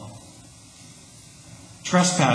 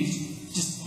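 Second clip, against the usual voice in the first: normal talking voice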